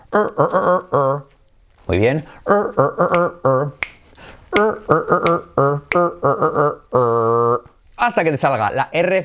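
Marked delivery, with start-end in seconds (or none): 0.1-1.4 short repeated er drills, pitch rising on the first; drilled er sound. Shown as a demonstration. Teacher drilling students on the English er sound. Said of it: "repite así". 2.5-7.7 short repeated er drills, pitch rising on the first; drilled er sound. Shown as a demonstration. Teacher drilling students on the English er sound. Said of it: "repite así".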